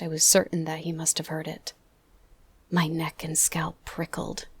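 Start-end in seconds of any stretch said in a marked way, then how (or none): none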